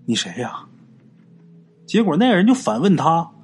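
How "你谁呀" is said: quiet, hesitant, scared asking; a hushed, nervous who-are-you; timid question. Acted. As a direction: ask it softly, afraid of the answer. Scared man in dark field.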